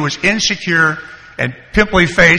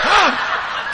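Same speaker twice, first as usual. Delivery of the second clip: sharp, high mock gasp; exaggerated intake of breath; shocked gasp; 13 semitones higher than usual. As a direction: gasp as if suddenly terrified, played for laughs. Retold shock at the sheriff.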